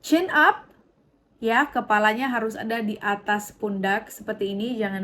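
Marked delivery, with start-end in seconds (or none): 0.1-0.7 higher, bright call of "chin up"; cheerful reminder. Shown as a demonstration. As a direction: coach them: "chin up". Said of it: "Ingat ya". (seruan semangat "chin up")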